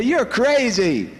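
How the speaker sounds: drawn-out teasing verdict of crazy; mock dismissal. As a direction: psychiatrists dismissing the speaker, mock scoffing. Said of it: "They say".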